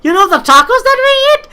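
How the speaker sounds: high, loud exclamation breaking into laughter; laughing disbelief. Real, not acted. Amused to viewers about Spanish food in America, laughing disbelief. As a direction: exclaim it way up high, then crack up. Mock amazement at tacos, laughing.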